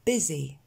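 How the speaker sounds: "Busy" with an è-like first vowel, bezzy; pronunciation model. Shown as a demonstration. Teacher showing the unexpected E sound, pronunciation model. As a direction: say "Busy" with the U as a short E. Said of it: "se prononce en E".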